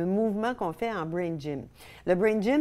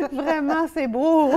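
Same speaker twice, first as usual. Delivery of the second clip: much higher, laughing delight; 9 semitones higher, 9 dB louder, slower than usual. Real, not acted. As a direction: say it still laughing. Laughing at the exercise. (rire ravi, voix très aiguë)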